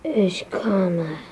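sneaky whisper. Acted. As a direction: whisper while creeping up.

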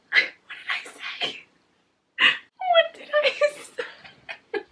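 breaking from voiceless gasp to high squeal; worked-up, very high, drawn-out squeal; overwhelmed squeal. Real, not acted. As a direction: squeak it out, overcome. Overcome, repeating herself while reading.

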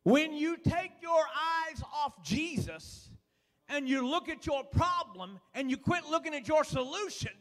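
normal talking voice

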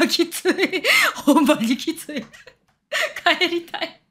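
high, laughing through the words; helpless laughter while protesting; laughing cringe. Real, not acted. Customer recalling being called princess, laughing in cringe.

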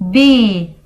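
ordinary delivery

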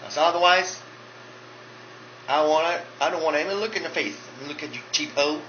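loud, sassy diva voice; sassy drag queen. Acted. Drag queen Monica boasting to fans, loud and sassy. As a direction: strut it like a brassy drag queen.

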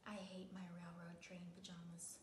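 whispered, sulking; sulky whisper. Acted. Boy sulking about his pajamas, whispered. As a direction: mutter it under the breath.

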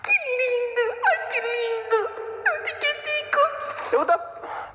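very high, slow squeaky cute voice; mock cutesy. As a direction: coo like over a baby.

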